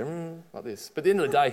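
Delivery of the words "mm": smug hum. Retold or imitated. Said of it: "he'd just go".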